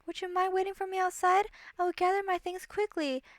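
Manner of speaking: high, composed royal voice; calm, diplomatic princess. Acted. Princess placates the monster, calmly diplomatic. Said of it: "the ex-princess calmed herself and tapped into her many years of".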